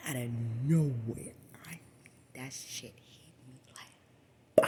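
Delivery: slow hushed whisper. Retold or imitated. Sudden hit, hushed retelling.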